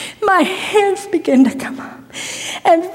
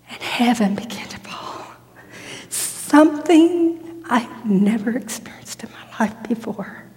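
hushed whisper; 3 semitones lower, 5 dB quieter than usual. Real, not acted. Hushed retelling of spiritual experience.

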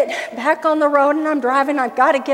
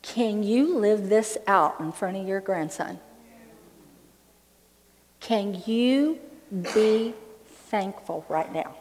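hushed, lower, much softer voice; soft inner voice; 7 semitones lower, 10 dB quieter than usual. Retold or imitated. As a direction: let the Spirit ask gently, almost a whisper. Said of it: "But my spirit was saying".

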